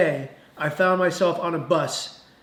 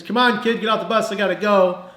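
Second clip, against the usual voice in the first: higher, louder hurry-up call; impatient bus driver; 4 semitones higher, 5 dB louder than usual. Retold or imitated. Bus driver ordering a crying kid off, impatient call. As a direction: call out to move someone along.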